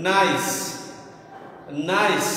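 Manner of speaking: নাইস said clearly twice as a model; modelling the word নাইস. Shown as a demonstration. Teacher to students, modelling নাইস clearly. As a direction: pronounce নাইস slowly for students. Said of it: "এই শব্দটাকে যখন উচ্চারণ করছি".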